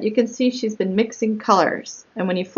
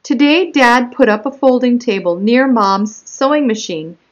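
animated story narration with punched key words; picture-book read-aloud; 3 semitones higher, 7 dB louder than usual. Acted. Child narrator telling of her own spot, read as lively story narration. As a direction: read it out clearly and expressively to young children.

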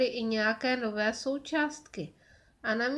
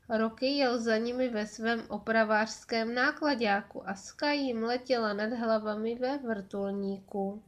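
usual speaking voice

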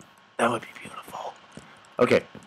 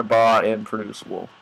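ordinary talk, nothing marked

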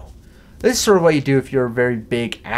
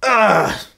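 loud high groan of disgust; disgusted ugh; 7 semitones higher, 4 dB louder than usual. Real, not acted. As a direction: groan at the ugly sticker. Disgust at stickers.

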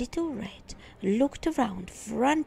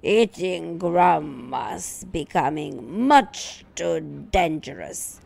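louder, slow wolf complaint; grumbling wolf; 3 semitones lower, 7 dB louder than usual. Acted. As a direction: complain like a sore, beaten wolf.

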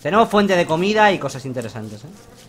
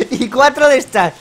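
laughing; 6 semitones higher, 5 dB louder, slower than usual. Real, not acted. Laughs at loot.

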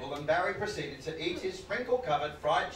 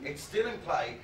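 ordinary talk

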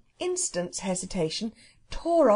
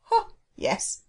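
high, knowing "ho" from a homely cook; scoffing Irish cook; 11 semitones higher than usual. Acted. Cook answering the boy who says it's real, scoffing. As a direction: brush it off in a high servant voice.

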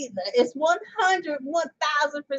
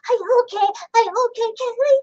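much higher and louder squeaky singing; chipmunk voice; 6 semitones higher, 5 dB louder, faster than usual. Pretend. Mocking the chipmunk singers at the song's end, high and louder. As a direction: sing it like a sped-up chipmunk. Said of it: "sounding like chipmunks".

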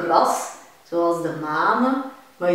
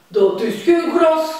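growling cow; 9 semitones higher, 10 dB louder than usual. Acted. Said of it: "gromt de koe".

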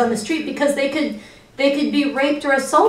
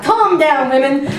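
mocking catcalling guys; 3 semitones higher, 4 dB louder, slower than usual. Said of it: "That's the refrain we get a lot".

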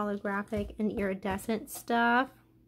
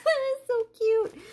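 much higher, louder gushing cute; delighted laugh and squeal; 12 semitones higher, 4 dB louder than usual. Real, not acted. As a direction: giggle then gush over it. Delighted by sloth mermaid.

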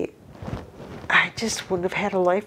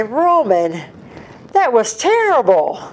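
mock scolding; 6 semitones higher, 4 dB louder, slower than usual. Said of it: "I say".

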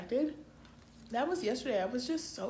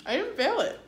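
high, thrilled; excited; 10 semitones higher, 3 dB louder than usual. Real, not acted. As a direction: announce it gleefully. Happy test news.

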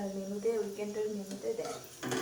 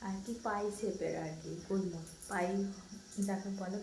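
normal talking voice

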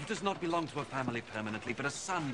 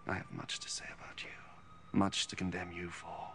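much softer, breathy whisper; 3 semitones lower, 9 dB quieter than usual. Acted. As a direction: hush it to a whisper. Jesus answering the Pharisees.

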